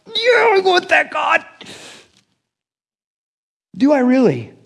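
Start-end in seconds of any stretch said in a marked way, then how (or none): 0.1-1.4 high, excited cry to God; pumped-up zeal. Retold or imitated. Preacher imitating his own pumped-up prayer, high and excited. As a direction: fire it off like you're all pumped. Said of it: "I get all pumped and I'm like".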